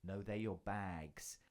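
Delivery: put-on deadpan child. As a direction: deliver a blunt correction, slowly and deadpan. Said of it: "he looked me dead in the eye".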